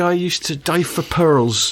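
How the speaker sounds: slow, lightly voiced driving instructor boast; mock instructor. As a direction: drag it out like a deadpan tall tale.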